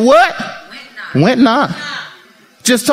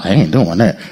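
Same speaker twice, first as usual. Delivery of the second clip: much lower, grumbled "I ain't doing that"; low inner refusal; 13 semitones lower than usual. Acted. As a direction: say it the way the heart really answers. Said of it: "In your heart".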